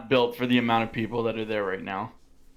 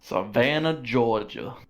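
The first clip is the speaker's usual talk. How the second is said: Southern drawl; slower than usual. Shown as a demonstration. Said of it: "This is so fun to say".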